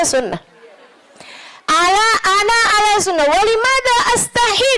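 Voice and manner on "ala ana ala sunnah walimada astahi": very high-pitched mock protest; retold indignant protest. Sheikh insists he follows sunnah, high indignant protest. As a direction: act out an affronted self-defence in a raised pitch. Sheikh defends himself in Arabic.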